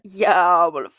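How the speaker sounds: hammed-up lustful growl, dropping low; thirsty boy. Rowan ogling her at her desk, hammed-up thirsty voice. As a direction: ham up the lust, sinking very low. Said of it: "You thirsty little boy".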